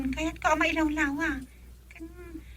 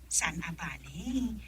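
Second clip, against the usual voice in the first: softer, slow dismissive question; 7 semitones lower, 10 dB quieter, slower than usual. Acted. Wife brushing off her husband's money worry, softly and slowly. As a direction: let it drop low and unhurried.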